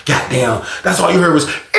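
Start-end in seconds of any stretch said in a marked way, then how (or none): 1.4-1.8 imitated alarm.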